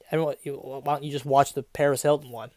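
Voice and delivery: usual speaking voice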